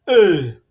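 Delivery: A as a loud falling call; stressed A, loud. Shown as a demonstration. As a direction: punch the A high and loud. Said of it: "Syllabic stress".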